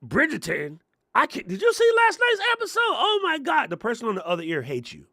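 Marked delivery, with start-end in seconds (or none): 0.1-3.7 very high, squealy gossip voice; shrill mock chatter about Bridgerton; mock gossiping caller. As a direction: gush about last night's episode. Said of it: "Be like".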